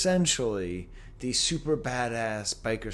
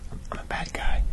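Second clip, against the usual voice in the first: whispered confession at work. Real, not acted. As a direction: hush it, half joking. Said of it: "I'm actually at work".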